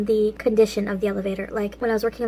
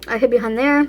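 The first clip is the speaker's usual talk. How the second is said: ordinary delivery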